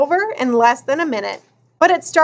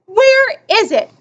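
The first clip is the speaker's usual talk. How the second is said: demanding; 8 semitones higher, 3 dB louder than usual. A narrator's reading. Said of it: "demanded Russell".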